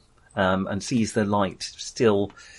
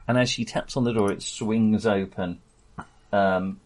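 ordinary delivery

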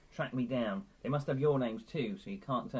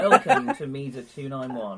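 Jar Jar voice; 13 dB louder than usual. Acted. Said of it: "was that Jar Jar?".

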